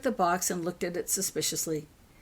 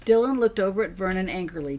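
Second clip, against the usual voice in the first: ordinary delivery, nothing marked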